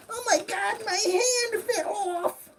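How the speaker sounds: very high, mock crying wail; hammed-up sobbing through laughter; wailing toy. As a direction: dork figure crying over his broken hand. Figure voiced after losing hand.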